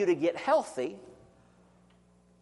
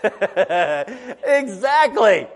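slow, laughing "Exactly"; 6 dB louder, much slower than usual. Real, not acted. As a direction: chuckle through the word. Laughing at the speeding answer.

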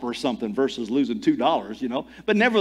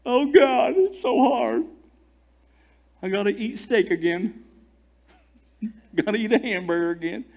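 higher, hammed-up complaining whine; mock whining; 5 semitones higher than usual. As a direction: whine like a spoiled grumbler. Mock grumbling Israelites.

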